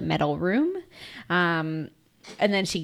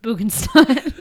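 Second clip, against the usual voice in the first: mock Czech; 4 semitones higher, 3 dB louder than usual. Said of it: "What's good morning in Czech?".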